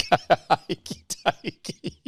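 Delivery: laughing. Real, not acted. Laughing at being called helpless.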